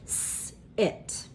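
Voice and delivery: "S, it" said as two separate whispered sounds; segmented sounds. Shown as a demonstration. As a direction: whisper "S" then "it", split apart. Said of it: "I need to go like this".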